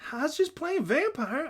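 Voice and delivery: high, loud, drawn-out excuse; protesting child. A narrator's reading. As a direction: plead your innocence in a high voice. Child defends himself to calm teacher.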